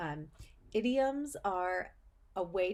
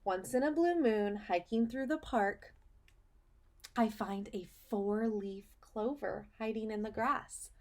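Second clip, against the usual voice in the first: put-on reading to children; 3 semitones higher than usual. Teacher reading a picture book to her students, storybook voice. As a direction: read it like storytime for little kids.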